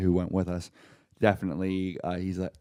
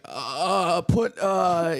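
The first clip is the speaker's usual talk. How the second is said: higher-pitched, stalling hesitation; stuck for words; 7 semitones higher, much slower than usual. Retold or imitated. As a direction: act out scrambling for an idea. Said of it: "I couldn't think of a quote".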